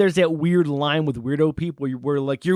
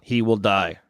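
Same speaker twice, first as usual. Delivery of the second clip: lightly done, lower Russian heavy; low mock-Russian movie villain line; Ivan Drago; 5 semitones lower, slower than usual. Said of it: "That's my Ivan Drago".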